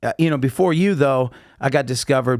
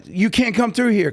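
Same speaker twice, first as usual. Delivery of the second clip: higher-pitched imitation of an urgent order; retold urgent call, pitched up; mock guard's warning; 8 semitones higher than usual. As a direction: act out a guard stopping runners. Security guard blocking the fleeing runners.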